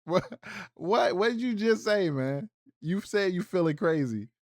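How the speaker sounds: what did she just say?!; incredulous. Real, not acted. Catching her admitting she felt crazy, loudly incredulous. Reacting in disbelief to her words.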